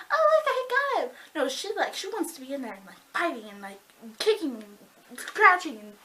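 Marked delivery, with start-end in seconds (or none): 0.1-1.1 high, loud put-on voice; hammed-up high-pitched mockery; mocking heroine. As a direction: squeal it as a mock damsel. Mocks a helpless heroine.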